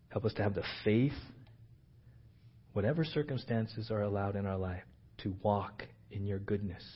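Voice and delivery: hushed, earnest prayer voice. Acted. Pastor praying quietly for his church, earnest and hushed. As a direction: pray softly over the congregation.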